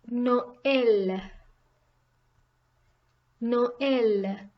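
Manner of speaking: "Noël" as two syllables, no-EL; both vowels pronounced. Shown as a demonstration. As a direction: sound the o and ë separately in "Noël". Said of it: "both need to be pronounced".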